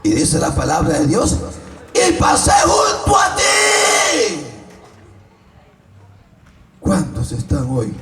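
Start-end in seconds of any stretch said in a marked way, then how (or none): 1.9-4.5 high, slow, drawn-out preaching shout; shouted scripture. Real, not acted. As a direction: cry out the verse at full force. Preacher proclaims God's words.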